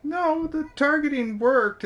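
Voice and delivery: high, slow insistent correction; emphatic correction. Acted. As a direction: correct them with rising insistence. GM stresses correction.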